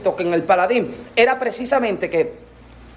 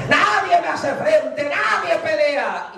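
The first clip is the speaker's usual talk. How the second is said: grito lento y alto del gigante; desafía al ejército a voz en cuello, despacio; grito desafiante; 5 semitones higher, slower than usual. Retold or imitated. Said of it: "aquel tipo está gritando". (Goliat grita su desafío, lento y agudo)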